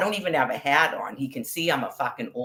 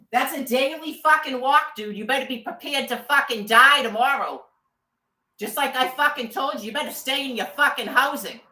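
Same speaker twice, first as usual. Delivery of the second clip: high-pitched furious warning; angry threat; 8 semitones higher than usual. Real, not acted. Woman warning harassers off her daily walk, furious threat. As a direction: warn them off with real rage, pitched high.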